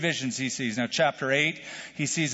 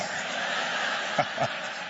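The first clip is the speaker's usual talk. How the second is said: soft laugh; 5 dB quieter than usual. Real, not acted. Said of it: "I couldn't resist".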